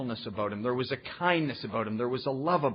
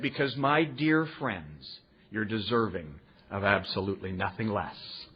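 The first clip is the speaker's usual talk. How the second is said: 1 tender; 6 semitones lower than usual. Retold or imitated. Quoting old-timer's blessing.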